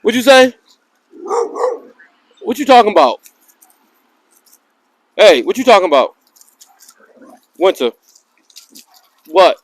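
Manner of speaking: playful loud retort. Real, not acted. Talking back to barking dog.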